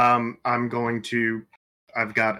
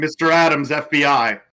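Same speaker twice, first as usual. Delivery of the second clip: raised, higher announcing shout; 4 semitones higher, 6 dB louder than usual. Pretend. Agent announcing FBI at the motel room, shouting. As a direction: call it out loudly, in character. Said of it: "I'm gonna shout".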